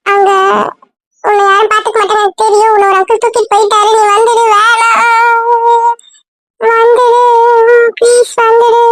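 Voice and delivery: high wail with long stretched vowels; tearful pleading. Acted. Frightened child begging her to return, crying. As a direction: build from whining protest to a drawn-out wail. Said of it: "அதெல்லாம் அழாத".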